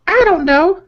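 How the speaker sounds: put-on high falsetto girlfriend voice reading texts; girly voice. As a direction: read her lines in a squeaky girly voice. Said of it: "girly voice for this part".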